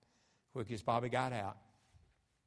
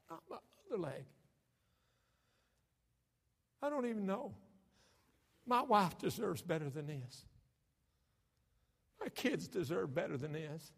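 high, pleading voice of a broken man; Bobby in despair; 11 semitones higher than usual. Retold or imitated. Bobby pleading with his pastor, high and despairing. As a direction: plead like a man who has lost his leg. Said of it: "Bobby said".

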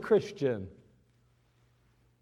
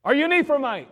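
higher, louder probing question; suspicious guard; 7 semitones higher, 5 dB louder, faster than usual. Acted. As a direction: interrogate the traveler. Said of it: "guard questioning".

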